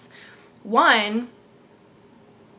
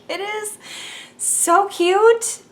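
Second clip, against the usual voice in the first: high, squealing gush; rising squeal of cuteness; gushing over kitten; 10 semitones higher, 4 dB louder, much slower than usual. Real, not acted. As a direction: melt over something adorable. Gushing about parents' kitten.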